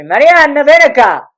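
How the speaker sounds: imitated father hollering for his daughter; calling out. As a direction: summon her from the basement. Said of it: "Now he calls me".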